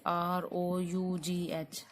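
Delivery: letters spelled slowly one by one; slow spelling out. Shown as a demonstration. Teacher spelling the word slowly for students. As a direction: spell आर ओ यू जी एच out carefully. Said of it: "जैसे".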